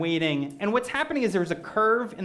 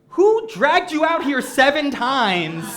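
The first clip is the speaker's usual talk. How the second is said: high, loud, slow disbelief; hammed-up appalled exclamation; incredulous outburst; 7 semitones higher, 6 dB louder, slower than usual. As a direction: demand it in comic outrage. Retold outburst of disbelief at stranger.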